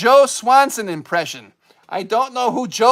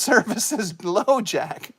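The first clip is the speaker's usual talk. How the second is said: ordinary talk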